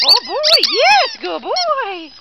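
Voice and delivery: high, slow excited praise for the dog; squeaky praising a dog. Real, not acted. Praising dog after fetch.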